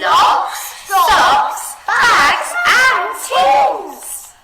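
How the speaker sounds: and stretched long, high and loud; listing with a huge drawn-out and; expressive list. Shown as a demonstration. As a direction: draw out and to show the mess. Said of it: "let's do it together".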